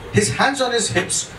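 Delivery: usual speaking voice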